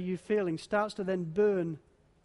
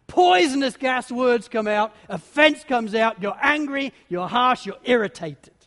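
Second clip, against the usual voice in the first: forceful build; 3 semitones higher, 8 dB louder than usual. Acted. Angry words pour out.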